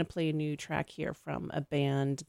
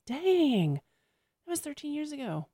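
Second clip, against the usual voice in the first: amazed; 10 semitones higher, slower than usual. Retold or imitated. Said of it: "you're like".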